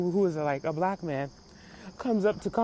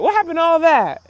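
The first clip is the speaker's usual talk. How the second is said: loud, high, incredulous question; exasperated outcry; 8 semitones higher, 10 dB louder than usual. Real, not acted. Calling out [name]'s old toughness, loudly exasperated. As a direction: throw the question out in loud disbelief. Challenging [name], repeated for effect.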